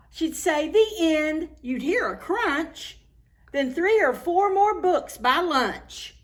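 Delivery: lively rhyming storybook read; reading to children. Acted. Reader tells children a rhyming story, lively storybook reading. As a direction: bounce the rhymes for young listeners. Reads rhyming picture book aloud.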